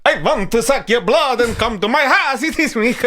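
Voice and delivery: high, hammed-up Transylvanian accent; mock vampire accent. Host ridiculing the comedian's accent, mock vampire accent. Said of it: "He can't even figure out which accent it is".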